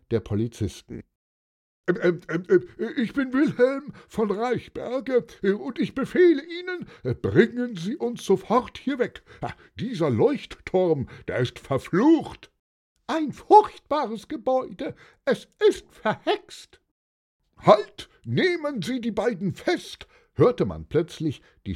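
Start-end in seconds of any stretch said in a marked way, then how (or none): 1.9-16.8 high, stammering agitated voice; flustered panic. A narrator's reading. Panicked impostor pleads with police. 17.6-20.1 very high, sudden yell; urgent shout. A narrator's reading. Antonius shouts for an arrest.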